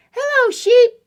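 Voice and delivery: high, loud duck voice; cheery duck greeting. Acted. Duck greets sheep while riding past, high duck call.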